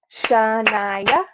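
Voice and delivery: Shania slowly drawn out, Sha-ni-a; syllable by syllable. Shown as a demonstration. Teacher counting beats in a name, Shania syllable by syllable. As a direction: clap out Shania in three long beats. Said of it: "three beats or three syllables".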